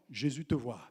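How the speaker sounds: low, soft reassurance; quiet tender reply; gentle reply. Acted. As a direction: answer softly, like a loving refrain. Preacher's repeated answer.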